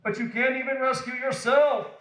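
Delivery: 높은 음으로 조롱하며 외치기; 군중처럼 비웃으며 소리쳐; mock shout. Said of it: "the people shouted".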